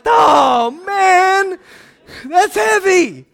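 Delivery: a startled groan at the load; overwhelmed sigh. Retold or imitated. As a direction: let the weight of it land slowly. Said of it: "I'm like".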